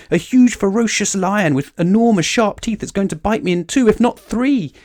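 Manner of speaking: high, loud panic, building to a long-held peak; hammed-up storybook hysteria; hysterical shrieking. A narrator's reading. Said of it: "shrieked Mister Jelly".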